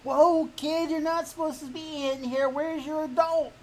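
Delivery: high-pitched mock challenge to a kid; imagined security guard. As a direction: play a startled guard stopping a child.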